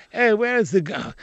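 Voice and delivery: the same line in a light English accent; English accent, only lightly put on. Shown as a demonstration. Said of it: "Now I have to say".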